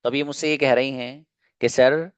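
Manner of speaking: ordinary talk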